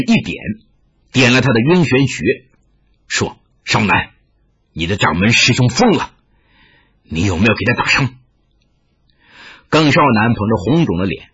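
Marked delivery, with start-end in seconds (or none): 3.6-8.1 put-on low-pitched old master, concerned; grave elder Taoist. A narrator's reading. Taoist master asking an injured disciple about wounds, low and grave. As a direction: speak low, as a sober master checking on his pupil.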